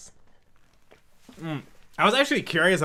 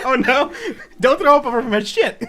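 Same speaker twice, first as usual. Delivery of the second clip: talking through laughter; laughing while he pleads; 7 semitones higher, 6 dB louder than usual. Real, not acted. Laughing at friend's suffering.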